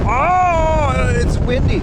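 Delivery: high excited yelp; excited exclaiming. Real, not acted. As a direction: squeal at the wind and the view. Hit by wind on observation deck.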